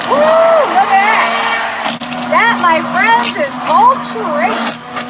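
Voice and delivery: loud, high, slow triumphant cry; excited shout. Real, not acted. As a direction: show it off like a proud win. Excited showing off the trace.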